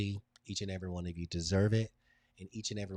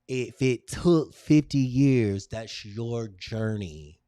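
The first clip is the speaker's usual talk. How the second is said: much louder, slow encouragement; emphatic; 11 dB louder, much slower than usual. Real, not acted. Speaker telling Pam her journey counts, slow and emphatic. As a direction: press it home to Pam.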